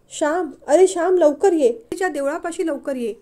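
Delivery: frightened cry. Acted. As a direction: beg him to come quickly. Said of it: "आणि ती जोरात किंचाळली". (घाबरून जोरात हाक)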